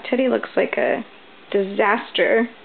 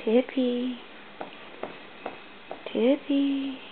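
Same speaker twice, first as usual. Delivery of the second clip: soft; 10 dB quieter, much slower than usual. Real, not acted. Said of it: "soft calling".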